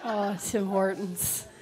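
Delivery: ordinary delivery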